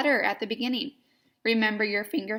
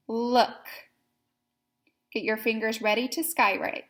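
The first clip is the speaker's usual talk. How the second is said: usual speaking voice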